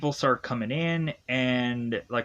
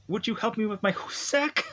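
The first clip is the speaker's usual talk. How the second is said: fake Swedish girl; 9 semitones higher than usual. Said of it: "is to say".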